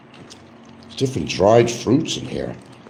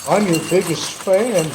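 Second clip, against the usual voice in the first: higher fawning fan voice; mock fan voice; 11 semitones higher than usual. Fan greeting Frank, lightly mocking gushing voice.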